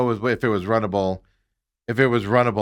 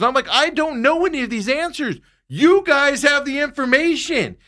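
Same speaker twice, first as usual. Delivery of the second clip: exasperated; 12 semitones higher, 3 dB louder than usual. Retold or imitated. Exasperated retold argument.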